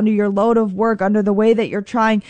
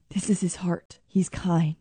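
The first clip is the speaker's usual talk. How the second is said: soft, low, near-whispered aside; hushed; 4 semitones lower, 8 dB quieter than usual. Real, not acted. Preacher telling listeners of God's kind heart, hushed. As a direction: drop to a tender hush.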